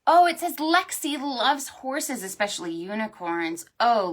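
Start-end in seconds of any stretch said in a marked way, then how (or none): none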